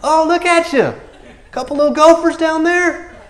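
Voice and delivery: high, loud, fast mocking jeer; trash talk. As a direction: taunt him like a playground bully. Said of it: "They talk trash back to him".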